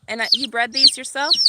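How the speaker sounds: ordinary talk, nothing marked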